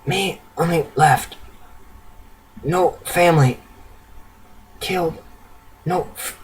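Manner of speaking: frail, soft, broken fragments; halting plea with long gaps between words; raspy, terrified. A narrator's reading. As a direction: speak as a weak, frightened refugee scraping words out. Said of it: "broken speech and raspy, terrified voice".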